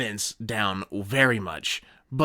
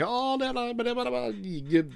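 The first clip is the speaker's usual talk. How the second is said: much higher, playful put-on voice; mock zealot voice; 10 semitones higher, faster than usual. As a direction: ham up the supporters. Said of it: "It was like".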